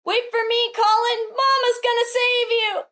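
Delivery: high, theatrical calling out; acted cry. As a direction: play a mother calling desperately to her child. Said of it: "thought bubble and cries".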